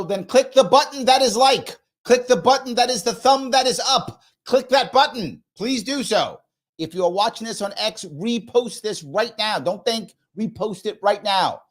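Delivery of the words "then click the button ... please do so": raised, sing-song plea to hit like; playful coaxing. Acted. Host teasing viewers into clicking like, playful raised plea. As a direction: tease them into clicking, pitched up high.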